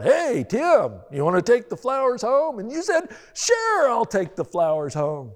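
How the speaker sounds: wife teasingly playing the careless husband; mocking imitation. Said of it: "I bet you're just walking out tonight".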